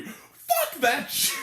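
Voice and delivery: very high, drawn-out shout; scornful outburst. Real, not acted. Critic rejecting a character's sudden apology, high scornful shout. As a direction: shout it slowly in comic outrage.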